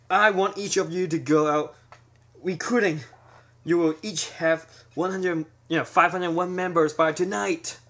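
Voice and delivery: hammed-up mob boss yelling commands; rival boss ordering. Rival boss sending his men out recruiting, loud shouted orders.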